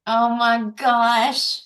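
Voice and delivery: ordinary delivery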